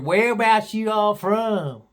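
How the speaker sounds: high, slow mock Southern drawl; deep Southern accent. Gal asks visitors' hometown, high mock Southern drawl. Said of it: "She had a real deep Southern accent".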